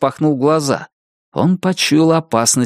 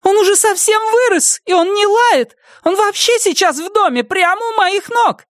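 much higher, thin, like helium. Acted. Nettie defending her dog, high thin squeak. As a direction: squeak it like you've inhaled helium. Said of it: "Голос Нетти стал высоким и тонким".

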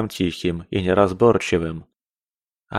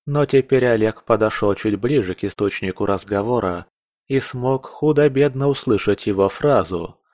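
ordinary delivery, nothing marked

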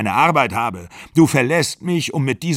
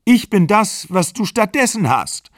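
higher and louder, near crying; shrill tearful outburst; 6 semitones higher, 4 dB louder than usual. A narrator's reading. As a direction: she hurls her hurt at him. Pegin cries out in quarrel.

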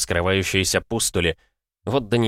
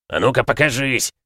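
demanding; 5 semitones higher, 3 dB louder than usual. A narrator's reading. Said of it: "потребовал Брабер".

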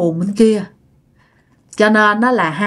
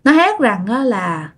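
usual speaking voice

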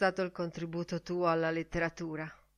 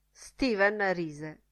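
plain reading voice

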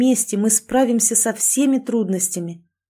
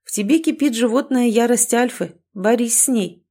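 usual speaking voice

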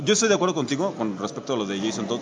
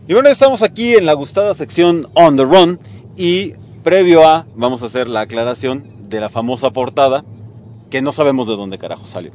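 show announcer; 9 dB louder than usual. Acted. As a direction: announce the segment like a radio host.

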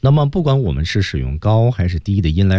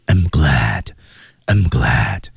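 low with full breath support; deep breathy; 5 semitones lower, much slower than usual. Shown as a demonstration. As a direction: push more breath through it. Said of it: "气息再足一点".

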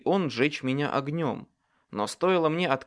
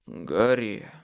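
low, defeated call of the name; weak voice; 5 semitones lower than usual. A narrator's reading. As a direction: say it weakly, giving up. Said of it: "слабым голосом произнёс он". (слабым низким голосом)

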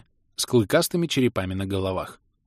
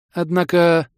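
higher, louder, drawn-in hook; leading hint; 7 semitones higher, 5 dB louder, slower than usual. A narrator's reading. Gnome teases the visitor with news, leading. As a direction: raise the word to hint at more.

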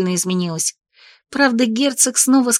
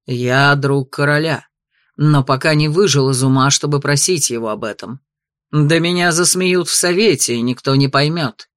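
stern duke; 6 semitones lower than usual. Acted. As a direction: refuse gruffly. Duke refuses.

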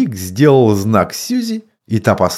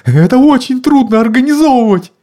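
frightened man; 14 semitones higher, 5 dB louder, faster than usual. A narrator's reading. Mr Wilkes frightened.

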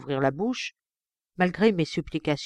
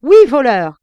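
much louder, higher shout; furious yell; 6 semitones higher, 15 dB louder than usual. Acted. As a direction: scream the word in rage. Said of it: "criai-je, fou de rage".

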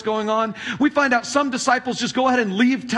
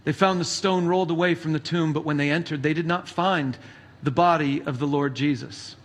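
measured, solemn Bible reading; slightly low, steady reading voice; scripture reading; 5 semitones lower, 3 dB quieter than usual. Acted. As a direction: read the Gospel to the congregation. Reads scripture passage aloud.

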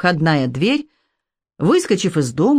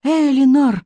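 terrified pleading hostage; 7 semitones higher, slower than usual. A narrator's reading. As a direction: call out in rising panic. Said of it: "дрожащим голосом прошептала она".

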